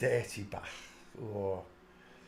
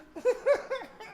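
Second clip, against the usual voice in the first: high squealing laugh; laughing fit; 4 dB quieter than usual. Real, not acted. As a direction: crack up at own voice. Laughing at own impression.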